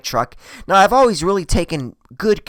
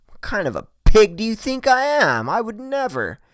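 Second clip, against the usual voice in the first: higher-pitched feigned indignation; mock offended; 9 semitones higher than usual. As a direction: protest as if deeply insulted, pitch raised. Feigned offence at being doubted.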